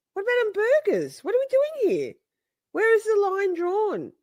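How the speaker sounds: high, incredulous questioning; mock alarm. Interrogating friend about cheese, high mock despair. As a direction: start quick, then stretch out the last question.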